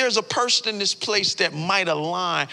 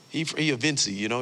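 normal talking voice

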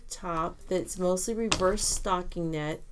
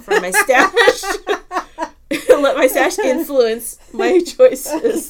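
laughing; 8 semitones higher, 15 dB louder, slower than usual. Real, not acted. Laughing at own excuse.

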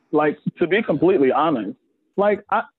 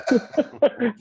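laughing; 8 semitones higher than usual. Real, not acted. Laughing while reassuring.

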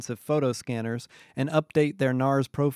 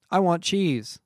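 higher, louder announcement; blurted order; 6 semitones higher, 4 dB louder, slower than usual. A narrator's reading. Isaiah blurts his order.

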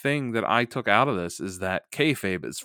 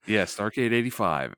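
normal talking voice

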